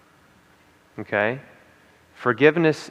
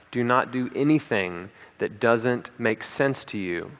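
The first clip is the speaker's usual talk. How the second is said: ordinary talk, nothing marked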